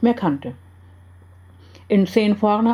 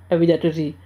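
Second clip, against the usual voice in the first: usual speaking voice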